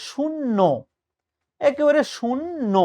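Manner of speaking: শূন্য drawn out, shun-NO; demonstrated o ending. Shown as a demonstration. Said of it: "যদি এখানে একটা ওকার দিত".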